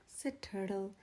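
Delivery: ordinary delivery, nothing marked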